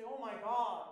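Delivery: preacher mimicking people exclaiming in surprise; loud exclamation. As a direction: blurt it out.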